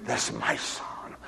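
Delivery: slow, breathless whisper on That's. Acted. Preacher claiming her son, whispered slowly. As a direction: hush it, like a secret vow.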